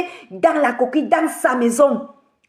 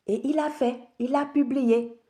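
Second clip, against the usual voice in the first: grave, hushed revelation; 9 dB quieter than usual. Real, not acted. Telling brothers and sisters he published it, grave and hushed. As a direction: let the fact land quietly.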